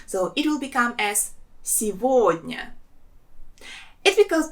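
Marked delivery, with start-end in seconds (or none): 1.6-2.7 сегодня as si-VOD-nya, g turned to v. Shown as a demonstration. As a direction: model сегодня with a v in the middle. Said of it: "we pronounce it as V".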